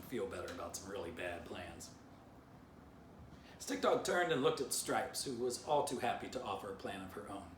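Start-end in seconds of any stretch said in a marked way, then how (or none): none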